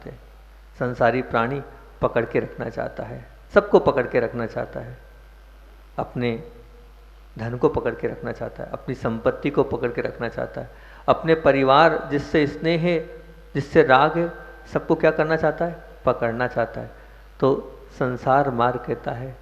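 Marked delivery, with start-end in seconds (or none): none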